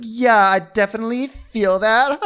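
very high, loud put-on voice; Victoria. Agreeing things have been awkward, high hammed-up voice. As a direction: agree in an exaggerated high squeal, ending on a laugh. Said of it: "And Victoria's like".

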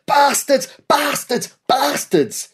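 high, bawled battle cry; bawling. A narrator's reading. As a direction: bellow it while charging. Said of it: "bawling at the top of his voice".

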